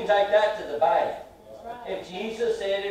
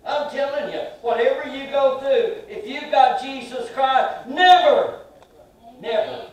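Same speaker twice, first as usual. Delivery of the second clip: loud, much higher cries of never; preaching at full force; 7 semitones higher, 7 dB louder than usual. Acted. Preacher to the congregation, crying out never at full force. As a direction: shout never back at every trouble.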